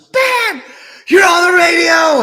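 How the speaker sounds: high, loud excited shout; daughter's scream. Retold or imitated. Daughter shouting to dad he's on the radio, loud high yell. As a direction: yell it out like a thrilled kid. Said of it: "And Mary screams so loud".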